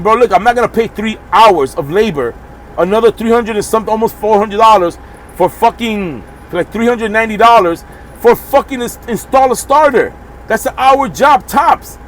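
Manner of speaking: high, insistent, slowing to hammer it home; heated complaint. Retold or imitated. Trucker telling the supervisor he won't pay, heated and insistent. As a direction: drag out the last words in disbelief.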